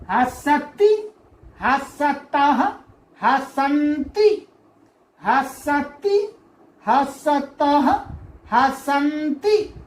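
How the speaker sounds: slow, stretched हंसति, हंसतः, हंसन्ति; slow recitation. Shown as a demonstration. Teacher drilling verb forms three times for the class. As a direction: chant हंसति, हंसतः, हंसन्ति slowly, drawn out. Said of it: "तीन तीन बार बोलना है आपको".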